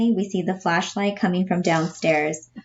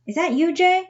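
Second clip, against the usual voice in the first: surprised; 11 semitones higher than usual. Acted. Louie surprised in dark.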